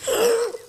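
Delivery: high startled gasp; sharp gasp. Real, not acted. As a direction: gasp in surprise. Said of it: "Why can't I say that?".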